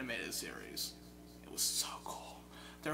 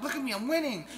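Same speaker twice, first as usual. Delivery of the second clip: mock gloating; 10 semitones higher, 7 dB louder than usual. Gloating at friend.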